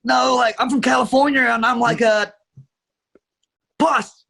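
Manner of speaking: surfer dude. Pretend. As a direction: play the surfer dude for a guessing game. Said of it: "The surfer".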